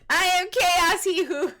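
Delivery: loud, high mock Russian accent; hammed-up Russian accent, shouted for fun. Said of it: "Why are you speaking with a Russian accent?".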